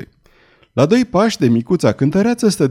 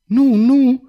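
high-pitched, anguished cry; 11 semitones higher than usual. A narrator's reading. Girl protesting to the young man, crying out. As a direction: cry out the denial, pitch leaping up. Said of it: "strigă ea".

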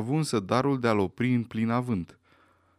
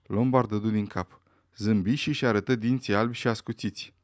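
ordinary delivery, nothing marked

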